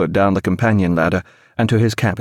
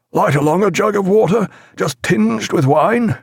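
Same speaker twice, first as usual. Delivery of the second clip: higher, slowing request for water; weak captain ordering; 11 semitones higher than usual. A narrator's reading. Jack asks Grimble for water, tired. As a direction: ask for water, drained and thirsty.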